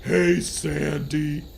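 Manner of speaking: loud, very slow cartoon dope voice; Patrick. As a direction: call out loudly, stretching each word. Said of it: "Patrick began".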